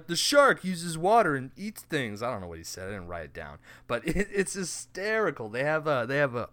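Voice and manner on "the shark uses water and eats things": mock TV narrator, higher and louder; over-enthusiastic educational voiceover. Co-host parodying a shark show's narrator, over-enthusiastic voiceover. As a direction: overplay it like an educational show host. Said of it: "over-enthusiastic educational voiceover".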